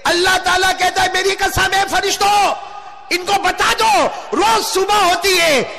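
high-pitched sermon shout; fiery preaching. Acted. Preacher declaring Allah's oath to the congregation, fiery preaching. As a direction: proclaim it at full pitch.

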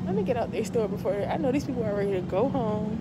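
muffled behind face covering; speaking with mouth covered; through a cloth mask. Real, not acted. Seen 5: Patterned cloth mask covers mouth and nose throughout the labelled words.

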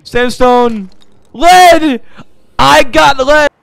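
very loud, high cries; jubilant shouting. Real, not acted. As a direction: escalate into a triumphant yell. Finally finds the needed lead.

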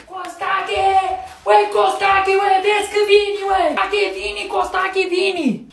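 high, urgent yelling; screaming for husband. Acted. Wife calling her husband as baby comes, screaming. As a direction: yell it out high and frantic. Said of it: "Și tot țâpi atâta".

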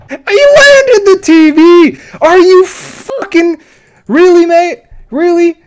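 high, loud and drawn out in disbelief; exasperated yell. Real, not acted. Yelling at a player who wasted his time, in loud disbelief. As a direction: shout the outrage slowly, stretching each word.

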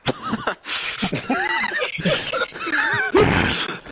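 shrieking laugh. Real, not acted. Big laugh at mum joke.

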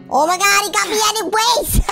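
high, dramatic game panic; caught-out wail. Pretend. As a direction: wail that he got you.

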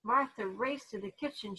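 plain reading voice

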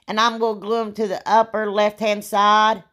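ordinary talk, nothing marked

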